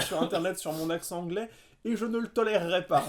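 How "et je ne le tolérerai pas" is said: louder, hammed-up offended protest, laughing through it; mock indignation. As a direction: pretend to be outraged, half laughing. Mock outrage at accent jokes.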